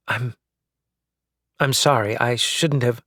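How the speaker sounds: ordinary delivery, nothing marked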